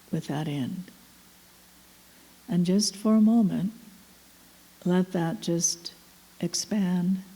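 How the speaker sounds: calm meditation voice with long, spacious pauses; unhurried, letting each phrase settle into silence; guided meditation. Real, not acted. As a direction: lead them inward, phrase by phrase.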